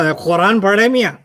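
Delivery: ordinary talk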